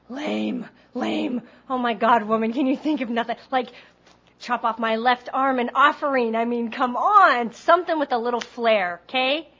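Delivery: loud, theatrical protest; acted exasperated outburst. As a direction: retell it as an over-the-top tirade. Retells her outraged inner protest.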